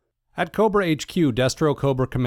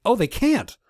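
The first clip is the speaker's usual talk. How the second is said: gleeful; 11 semitones higher than usual. Real, not acted. Gleeful correction of himself.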